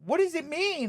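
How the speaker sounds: high and loud, innocent; naive teen question. Retold or imitated. Teen asking her father about his job, naive. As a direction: ask it wide-eyed and earnest. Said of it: "I was like".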